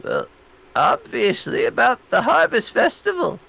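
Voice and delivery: put-on high, matter-of-fact old librarian; old lady voice. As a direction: state the obvious, old lady. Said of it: "she's like".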